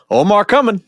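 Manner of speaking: high mock call-out; imitated wife. Co-host joking as the wife quoting The Wire while driving, mock call-out. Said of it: "She's driving down going".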